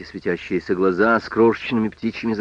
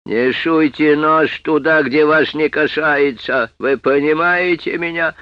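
loud, slow, higher furious threat; 7 semitones higher, 8 dB louder, slower than usual. Acted. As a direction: hammer each word, enraged. Policeman threatens Sam.

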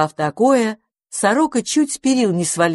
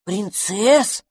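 higher-pitched puzzled question; questioning echo; 4 semitones higher than usual. A narrator's reading. Little dragon queries eating princesses, puzzled. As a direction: echo it back with rising curiosity. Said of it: "переспросил дракончик".

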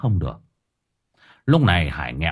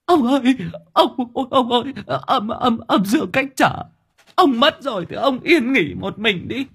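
high, tearful stammering plea; panicked wailing. Acted. Wife begs dead husband to let her go, panicked wailing. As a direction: beg in a frantic high-pitched wail. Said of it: "Bà lăn quay ra".

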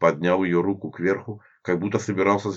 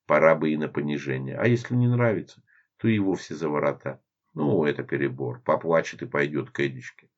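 plain reading voice

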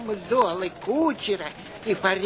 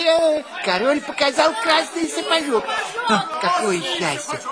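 excited; 8 semitones higher, 4 dB louder than usual. Acted. Father thrilled by the king.

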